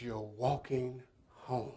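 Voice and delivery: low, soft and slow, a voice in his head; haunting inner echo. Retold or imitated. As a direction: let it echo darkly in memory. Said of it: "all I could think in my mind".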